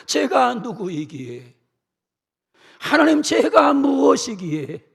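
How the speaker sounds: earnest, rising plea to God; David pleading humbly before God; humble pleading prayer. Acted. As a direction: cry out in lowly wonder.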